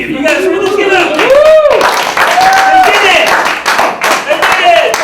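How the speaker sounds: high, loud celebratory shouting; rally the crowd into applause; whooping mock celebration; hyped-up cheer. Rallying applause for baby news.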